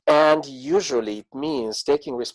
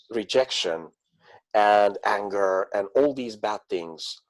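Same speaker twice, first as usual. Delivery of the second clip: normal talking voice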